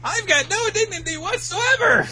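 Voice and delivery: very high whiny wail; Usopp wail. Retold or imitated. Usopp admitting no dignity, very high whiny wail. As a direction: whine it with no shame. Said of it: "Is Usopp".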